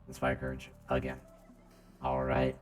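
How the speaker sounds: ordinary talk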